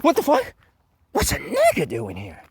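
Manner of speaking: outraged high yelp, then a put-on voice; mocking bigot. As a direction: sneer like a shocked bigot. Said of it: "you're like".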